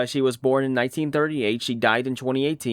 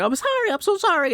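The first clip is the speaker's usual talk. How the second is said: high-pitched imitation of tearful apology; mock bawling. Mimicking the crying Jack in the Box girl, high mock bawling. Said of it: "And she's bawling".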